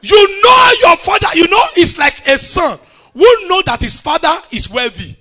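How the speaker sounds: loud, high-pitched sermon delivery; full-force preaching. Real, not acted. Preacher to congregation on God's wealth, full-force preaching. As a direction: drive the point home at full voice.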